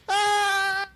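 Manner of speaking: high, loud scream fading into the dark; long high falling scream. Acted. Kid screams falling into darkness.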